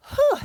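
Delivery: loud, high exhaled whew; winded whew. Real, not acted. Walker out of breath on a hill, loud winded whew. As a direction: blow out a big breath after exertion. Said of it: "I am super out of breath".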